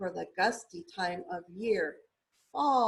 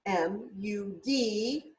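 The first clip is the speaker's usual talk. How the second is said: letters stretched out slowly; M U D spelled out slow; slow spelling; much slower than usual. Shown as a demonstration. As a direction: sound out each letter slowly.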